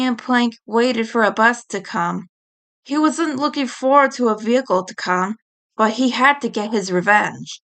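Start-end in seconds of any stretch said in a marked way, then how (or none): none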